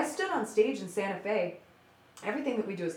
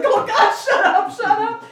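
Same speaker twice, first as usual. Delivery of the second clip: laughing mock plea; 14 semitones higher, 15 dB louder, slower than usual. Backstage assistant mortified, laughing.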